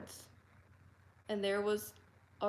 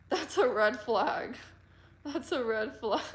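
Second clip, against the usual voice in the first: laughing; 3 semitones higher, 7 dB louder, slower than usual. Real, not acted. Laughing about a red flag.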